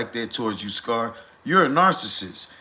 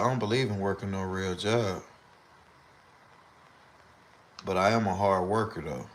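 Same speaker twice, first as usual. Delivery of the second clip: lower, softer mock Scar voice; imitating Scar; 7 semitones lower, 6 dB quieter than usual. Quoting Scar on not believing in jobs, low soft imitation. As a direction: drop low and quiet like you're doing him. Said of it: "He said".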